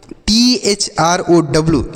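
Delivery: letter by letter, spelling T H R O W slowly. Shown as a demonstration. Teacher spelling T H R O W slowly for the children. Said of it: "इसे हम पढ़ते हैं throw".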